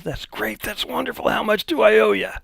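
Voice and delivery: high, pleased rich customer. Retold or imitated. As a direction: lift the voice for the delighted customer. Customer in fancy suit, quoted.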